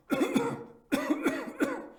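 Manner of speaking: a long, high, hacking cough; fit of coughing. A narrator's reading. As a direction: cough hard, unable to stop. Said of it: "found it impossible to reply".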